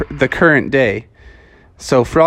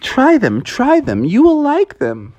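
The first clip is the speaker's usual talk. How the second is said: high coaxing sing-song; coaxing Froggy; 10 semitones higher than usual. Acted. Froggy coaxing Twinkle to taste the flies, high pushy. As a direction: urge her on in a high, pushy voice. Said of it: "And Froggy was like".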